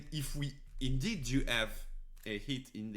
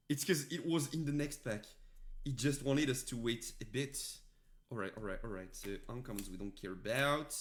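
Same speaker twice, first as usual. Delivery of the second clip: usual speaking voice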